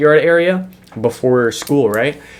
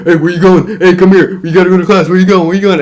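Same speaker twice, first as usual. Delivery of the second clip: raised, louder mock call of a school staffer chasing a kid; hollering after a kid skipping class, pitched up; 4 semitones higher, 5 dB louder, faster than usual. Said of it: "and he'd be like".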